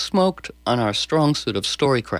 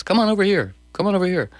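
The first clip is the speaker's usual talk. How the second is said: much higher, beckoning call; coaxing invitation; 8 semitones higher, faster than usual. Acted. Guest calls people over with an irresistible invitation. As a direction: lure everyone over with open arms. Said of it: "irresistible, magnetizing invitation".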